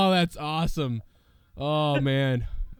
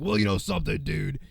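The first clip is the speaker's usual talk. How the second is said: Hulk Hogan voice; 3 semitones higher than usual. Retold or imitated. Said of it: "sounds more like Hogan now".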